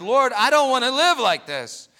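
high, loud, wailing prayer to be allowed to die; desperate crying plea. Retold or imitated. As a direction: cry out to God in anguish, pushing to a shout. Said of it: "He cried".

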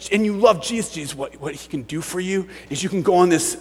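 ordinary delivery

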